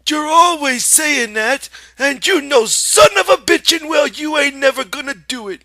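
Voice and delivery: sharp scolding aunt. A narrator's reading. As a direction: cut him off with contempt. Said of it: "she said sharply".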